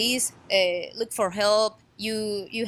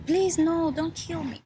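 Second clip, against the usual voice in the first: higher-pitched retold plea, not really shouted; retold yelling; 7 semitones higher than usual. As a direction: imitate a yelled plea in a raised pitch. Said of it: "She was yelling".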